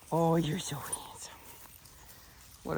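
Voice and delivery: soft, breathy whisper to the dog; whispered coo. Real, not acted. Trainer whispers praise to the dog, a tender whisper.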